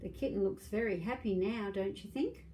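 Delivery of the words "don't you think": ordinary delivery, nothing marked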